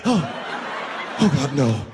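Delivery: breath-heavy, acted dread; dismayed victim. Passenger in pink shirt answering the demand, horrified moan. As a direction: moan it in horror, half voiceless.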